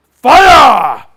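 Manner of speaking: huge, high shouted command; shouted order. Retold or imitated. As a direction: bark the firing order. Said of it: "in his best impression of an African soldier".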